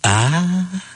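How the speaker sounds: put-on high, knowing "ah" from the old man; elderly diner's pointed aha. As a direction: land the punchline on a high, drawn-out "ah". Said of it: "And the old man goes".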